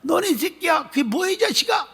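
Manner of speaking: high, shouted mock cursing; imitated shouting. Elder's wife berating the deacon, high mock shout. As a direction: yell it at him like the angry elder's wife. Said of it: "막 이러고".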